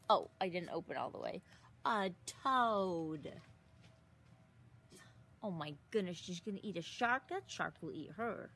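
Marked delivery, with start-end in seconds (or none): none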